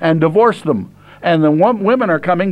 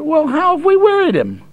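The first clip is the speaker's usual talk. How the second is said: much higher, feigned puzzlement; mock-innocent question; 11 semitones higher than usual. Preacher voicing the priests' protest, high and mock-innocent. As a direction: act falsely innocent, pitched up. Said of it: "then they answer".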